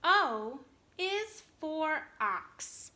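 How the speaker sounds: very slow and clear, word by word; slow model reading. Shown as a demonstration. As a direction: model it for young readers. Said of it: "I'm gonna read it to you".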